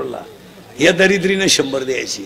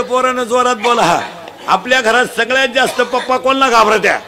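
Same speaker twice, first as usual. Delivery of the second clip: full-force preaching; 6 semitones higher than usual. Acted. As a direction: drive it home to the crowd. Quizzing the crowd loudly.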